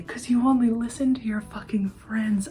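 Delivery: whispering the lyric along. Real, not acted. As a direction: mouth the line in a whisper.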